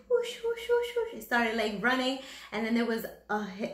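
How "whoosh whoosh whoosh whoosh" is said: very high whoosh noises; happy whooshing. Acted. The rider enjoying his camel ride, very high whooshes. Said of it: "He's like".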